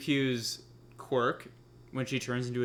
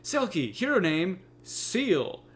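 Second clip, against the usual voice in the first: high, loud announcer's character breakdown; 8 semitones higher, 5 dB louder than usual. Retold or imitated. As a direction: belt out a hero profile like an announcer. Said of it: "I loved the announcer's, like, breakdown of Selkie".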